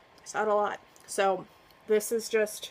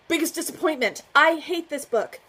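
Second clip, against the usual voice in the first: mock outrage; 7 semitones higher, 6 dB louder than usual. Real, not acted. Vlogger to viewers, announcing her most hated book, mock outraged. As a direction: ham up the disgust.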